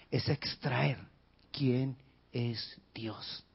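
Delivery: slow, soft whisper; preacher whispering the central question. Real, not acted. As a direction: breathe the question out slowly. Stressing the key point.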